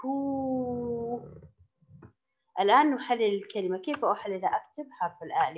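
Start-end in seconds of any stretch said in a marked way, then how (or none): 0.0-1.2 كو sustained on one steady oo; long vowel. Shown as a demonstration. Teacher repeating the long sound for pupils, كو sustained. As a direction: hold the vowel of كو long and level. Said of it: "هل يوجد فيها صوت طويل؟".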